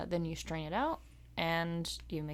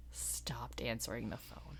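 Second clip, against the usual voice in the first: slow, hushed mock confession; confiding whisper; 8 semitones lower, 12 dB quieter than usual. Real, not acted. Coworker confessing they quit taking calls, slow whisper. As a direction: whisper it slowly like a secret.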